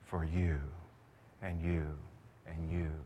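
tender. Acted. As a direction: speak it gently to each listener. Tender repeated address.

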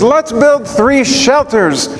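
high, slow and delighted; eager Peter. Retold or imitated. Peter offering Jesus three shelters, eager and high. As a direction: Peter proposing the booths with glee. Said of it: "So he says".

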